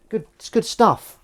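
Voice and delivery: pleased gush over the clean pile; proud excitement. Real, not acted. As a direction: show off the work with delight. Proudly showing the clean dishes.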